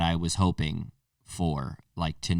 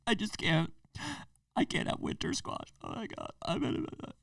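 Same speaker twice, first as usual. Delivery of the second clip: hammed-up whispered despair, breaking off; mock despair; 8 dB quieter than usual. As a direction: whisper it like a badly faked tragedy. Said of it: "And you're like".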